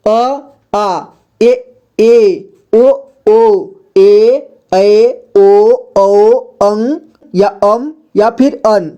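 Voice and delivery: slow vowel drill, each sound held apart; reciting vowels. Shown as a demonstration. Teacher shows learners how to read the vowels, measured recitation. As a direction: recite letter by letter, drawing out long vowels. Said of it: "जिस तरह से इसको पढ़ते हैं".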